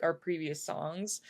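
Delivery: usual speaking voice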